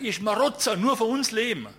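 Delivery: high, loud ranting shout; angry yell. Retold or imitated. Owner rants at the photographer as a freeloader, shouting. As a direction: rant it furiously. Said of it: "Dann sagt er".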